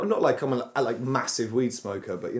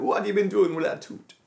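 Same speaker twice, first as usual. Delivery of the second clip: imitating a Jamaican dentist, pitched higher; higher mock Jamaican dentist accent; Rasta dentist; 8 semitones higher than usual. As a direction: mimic a friend's Rasta dentist voice. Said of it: "you turned into a Rasta dentist".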